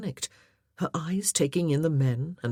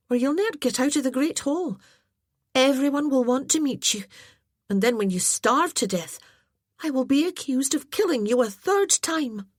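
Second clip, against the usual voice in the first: put-on much higher, fast eager girlish chatter; a lively girl joking about her clumsiness; chatty young lass; 9 semitones higher, 4 dB louder than usual. A narrator's reading. Aileen voiced, chatty.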